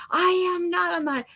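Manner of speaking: high, protesting denial, cut off mid-word; indignant denial. Retold or imitated. Husband snapping back that he is not on the highway, indignant. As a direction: bristle and deny, breaking off.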